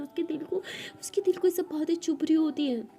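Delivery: ordinary delivery, nothing marked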